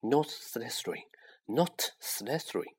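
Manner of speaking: desperate whisper, barely breathed; Harry silently begging the hat, whispering; whispered plea. Acted. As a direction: plead under your breath.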